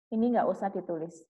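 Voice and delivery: ordinary delivery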